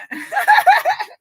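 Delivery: loud, very high cackle; real laughter. Real, not acted. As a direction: burst into shrieking laughter. Crying with laughter at clip.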